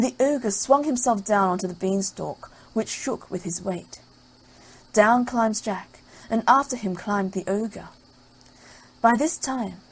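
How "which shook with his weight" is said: usual speaking voice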